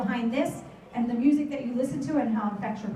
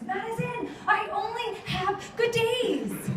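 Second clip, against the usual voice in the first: put-on higher, slow, exasperated child voice; a kid insisting through gritted teeth, dragged out slowly; exasperated little girl; 9 semitones higher, much slower than usual. Girl snaps at her sister after a scooter runs over her foot, higher and slow.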